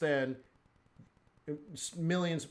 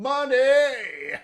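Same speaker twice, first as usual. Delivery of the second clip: excited shout; 11 semitones higher, 12 dB louder than usual. Real, not acted. Bursts out after hummed beat.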